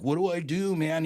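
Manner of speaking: high, loud plea for help; retold panic. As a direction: imitate a frantic young man asking for guidance. Retold panicked call to pastor.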